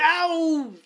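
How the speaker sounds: loud, high yelp of real pain; sudden pain yelp. Real, not acted. Clawed by the cat mid-podcast, sharp loud yelp. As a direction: cry out as the claws sink in.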